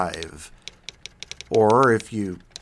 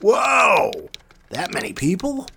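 mock amazement; 8 semitones higher, slower than usual. Host retelling seeing his listener stats, mock astonishment. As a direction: gasp it out as if stunned by the numbers. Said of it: "every few months when I'm like".

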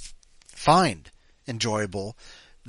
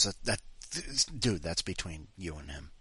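ordinary delivery

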